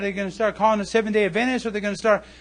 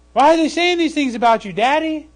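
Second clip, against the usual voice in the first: put-on higher, louder pleading child; hurt child; 7 semitones higher, 5 dB louder than usual. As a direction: ask daddy with a hurt voice. Children ask their father.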